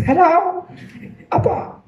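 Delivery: high put-on phone hello; squeaky fake caller greeting; disguised contest-caller voice. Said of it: "paiba-iba ng boses".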